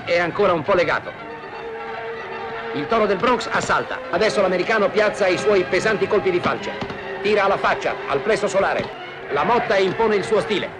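ordinary talk

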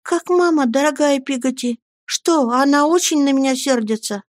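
put-on high-pitched boyish pleading voice; anxious child. A narrator's reading. Boy asking nanny about his mother, anxious high voice. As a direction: ask like a worried little boy.

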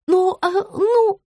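slow, high-pitched hemming; hesitant mumbling. A narrator's reading. Rita avoids answering her client, hesitant. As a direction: stall nervously in a small high voice.

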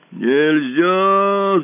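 loud snarling refusal; growling monster. A narrator's reading. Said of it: "зарычало чудовище".

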